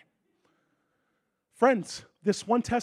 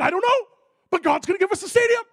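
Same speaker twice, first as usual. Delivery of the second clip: higher and louder, full of faith; confident retold reply; 11 semitones higher, 8 dB louder than usual. Preacher insisting to his wife, joyfully confident.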